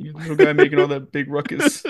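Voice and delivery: put-on baby talk to a dog.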